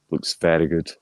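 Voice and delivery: ordinary talk, nothing marked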